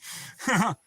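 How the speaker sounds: brief high chuckle; quick amused laugh; short real laugh. Real, not acted. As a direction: let out a little laugh. Laughs about thirsty woman.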